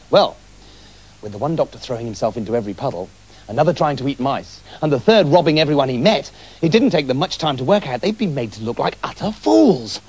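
usual speaking voice

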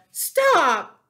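high, very loud cry to halt; panicked scream. A narrator's reading. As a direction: scream it as a terrified piglet. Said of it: "screamed Wilbur".

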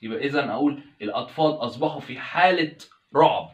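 usual speaking voice

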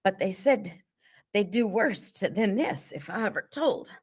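starting to cry. A narrator's reading. T J fears worse if he tells, starting to cry. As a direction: protest as tears come. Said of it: "T J began to cry".

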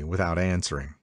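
usual speaking voice

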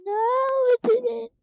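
high, slow fake wail; mock crying. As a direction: whine it like a hammed-up sob. Mock cry after no gun.

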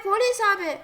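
normal talking voice

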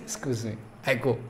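very soft, low apology; small, low voice of someone caught out; meek excuse. Retold or imitated. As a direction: slip away with a hushed excuse. Caught intruder excuses himself.

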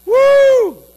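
high, loud woo; excited shout of woo; loud whoop. Real, not acted. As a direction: let out a joyful whoop. Preacher exclaiming.

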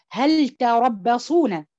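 "هل تربصون" loud, slow, لام clear; loud slow recitation. Shown as a demonstration. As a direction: recite it out boldly, slowly. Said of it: "يبقى". (تلاوة عالية بطيئة بإظهار اللام)

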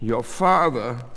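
slow, heavy groan. A narrator's reading. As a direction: groan it out from the bed. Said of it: "the voice groaned from the bed".

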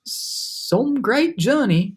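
drawn-out storybook sarcasm; sardonic grumble. A narrator's reading. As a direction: stretch it out with dry disdain. Centipede grumbles after rough trip.

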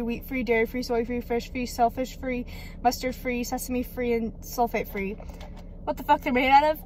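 ordinary talk, nothing marked

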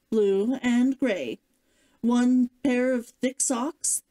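usual speaking voice

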